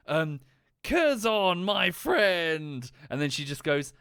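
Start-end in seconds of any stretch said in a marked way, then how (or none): 0.8-2.9 booming, high-pitched joyful hail; hammed-up warrior's delighted shout; hearty Klingon greeting. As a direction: roar it like welcoming an old comrade. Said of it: "gives her a bear hug, and says".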